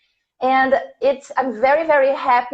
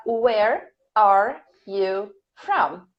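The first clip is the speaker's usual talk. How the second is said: slow, word-by-word dictation; each word set apart for students to write; 2 semitones lower, much slower than usual. Shown as a demonstration. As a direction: dictate it slowly, pausing between the words. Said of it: "This is dictation".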